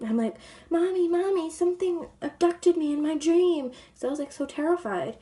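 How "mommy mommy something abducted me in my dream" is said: put-on scared little kid. Child runs to mother after nightmare.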